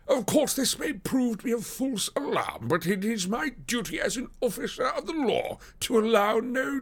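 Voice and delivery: dutiful detective with airs, pitched high; police detective, self-satisfied. Acted.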